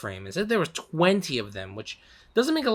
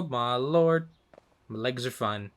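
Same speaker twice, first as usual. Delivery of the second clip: normal talking voice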